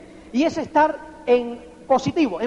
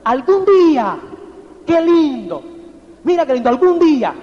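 louder, longing sigh of wonder; dreamy delight; 5 dB louder than usual. Shown as a demonstration. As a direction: savor it like a dream you'll own. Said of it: "fíjate esa expresión, apúntala". ("algún día" dicho más fuerte, soñando con ilusión)

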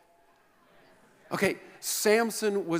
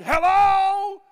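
very high, loud roared hello; violent roar; 9 dB louder than usual. Acted. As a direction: roar it into the phone. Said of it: "A violent voice roared".

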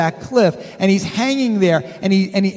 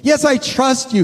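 eager hiker; 7 semitones higher than usual. Retold or imitated. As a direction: answer fast and keen. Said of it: "the voice says".

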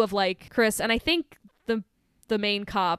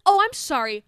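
high, loud mock apology; affronted, rising into a question; indignant retort; 4 semitones higher, 7 dB louder, slower than usual. Talking back to the selfish boyfriend, indignant.

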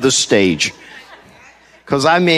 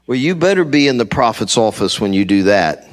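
ordinary talk

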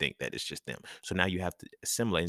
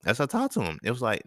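usual speaking voice

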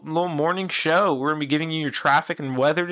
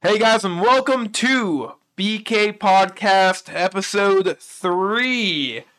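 slow, drawn-out host intro; podcast intro; 3 semitones higher, much slower than usual. Acted. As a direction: welcome listeners like a show host. Show intro greeting.

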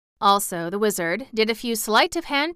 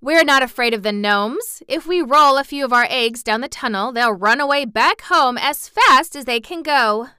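loud, raised, confident hen voice; bold cry; 6 semitones higher, 10 dB louder than usual. A narrator's reading. Hen scoffing at the gnomes, loud and fearless. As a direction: boast it out loudly. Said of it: "cried the Yellow Hen".

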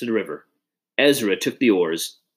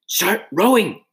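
high, urgent command; urgent, high-pitched order; giving an order; 10 semitones higher, 4 dB louder, slower than usual. A narrator's reading. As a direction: order it sharply, pitch raised. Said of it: "he ordered".

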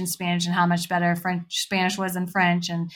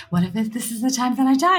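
acted-out jitters, louder and higher; retold nervous worry; 5 semitones higher, 4 dB louder, faster than usual. Skydiver confiding nerves to a friend, retold nervous worry. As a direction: blurt a quick, nervous what-if. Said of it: "so nervous and sweating".

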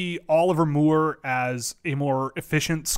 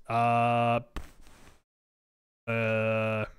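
ordinary talk

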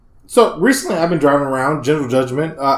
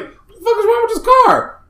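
much higher, stunned cry of disbelief; baffled outburst. Retold or imitated. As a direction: yelp it up high in shock at the car. Retold outraged reaction at car.

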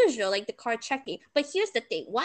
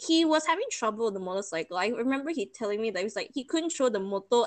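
ordinary delivery

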